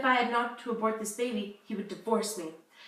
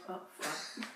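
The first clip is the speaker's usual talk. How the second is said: whispered curse; 5 semitones lower, 12 dB quieter than usual. Real, not acted. Actor breaks after flubbing line.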